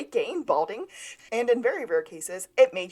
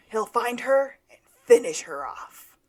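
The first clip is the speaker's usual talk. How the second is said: higher, louder dramatic pronouncement; 4 semitones higher, 7 dB louder than usual. Retold or imitated. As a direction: deliver it like an overblown one-liner. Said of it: "in a very dramatic voice".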